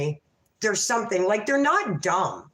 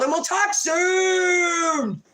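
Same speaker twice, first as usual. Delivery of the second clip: sing-song farewell; 8 semitones higher, much slower than usual. Real, not acted. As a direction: stretch it out like a cheery call to the chat. Calling out goodbye to viewers.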